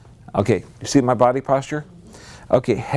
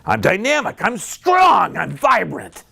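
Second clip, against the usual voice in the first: high, punchy self-affirmation; rousing demo, pitch lifted high; demonstrated empowered affirmation; 6 semitones higher than usual. Shown as a demonstration. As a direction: proclaim it with energy, head held up. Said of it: "volume, and intonation".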